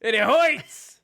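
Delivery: high mock Prison Mike whine; quoting Prison Mike, pained and high. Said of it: "Prison Mike".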